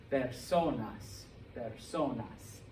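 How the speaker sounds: slow personas, long SO then falling; stress on O. Shown as a demonstration. Teacher confirms the rule, personas slowly stressed on SO. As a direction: lean on SO in each personas. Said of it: "Yeah, it's on O".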